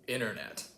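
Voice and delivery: internet as 'innernet', T dropped; American internet. Shown as a demonstration. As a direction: say 'internet' with no T, R kept. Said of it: "That's how I say it".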